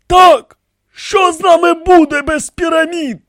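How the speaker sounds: very high, loud refusal; forbidding. A narrator's reading. High priest refuses the pharaohs, insistent.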